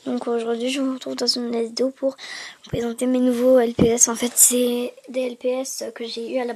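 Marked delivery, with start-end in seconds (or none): none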